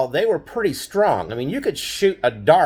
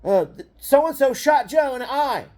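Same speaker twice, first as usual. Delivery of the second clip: tattling sister; 9 semitones higher than usual. Retold or imitated. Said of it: "tells my dad".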